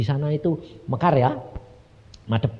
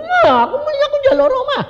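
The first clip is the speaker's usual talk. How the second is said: hammed-up high, loud whine; whining; 6 dB louder, faster than usual. Begging God for a house, high loud whining. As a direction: whine like a nagging child, very high. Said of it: "pokoknya ngerengek terus aku bilang".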